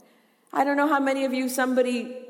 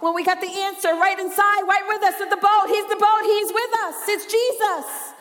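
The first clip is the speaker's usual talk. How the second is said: building full-force preaching; 7 semitones higher, 6 dB louder than usual. Real, not acted. Preacher chiding panicked believers, building full-force preaching. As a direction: climb from firm questioning to a high, loud cry.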